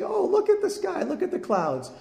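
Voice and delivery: high, gushing voice of someone marvelling at the sky; mock wonder. Preacher imitating people marvelling at clouds, high mock wonder. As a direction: play a believer delighted by a sign in the clouds. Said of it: "every day we look up and we say".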